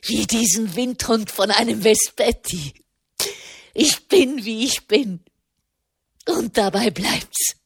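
lightly voiced cantankerous mother, irritated; störrische kranke Mutter. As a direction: send him away with stubborn irritation. (leicht gespielte störrische Mutter, unwirsch)

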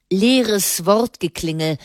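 ordinary delivery, nothing marked

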